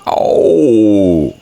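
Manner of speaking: loud, drawn-out bear "oh"; hammed-up storybook yelp; cry of pain. A narrator's reading. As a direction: cry out loudly, falling away. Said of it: "cried Pooh".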